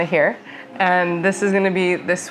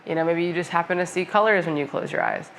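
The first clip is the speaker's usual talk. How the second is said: calm, softer meditation voice with long pauses; guided meditation; 5 dB quieter than usual. Acted. Yoga teacher guiding viewers through a senses meditation, calm guided meditation. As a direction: ease them into the breath and senses, unhurried.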